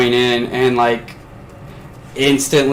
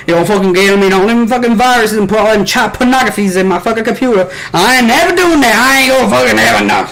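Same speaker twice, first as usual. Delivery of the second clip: high, loud mock Southern refusal; loud Southern accent; 8 semitones higher, 4 dB louder than usual. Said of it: "talking that fucking old-fashioned Southern".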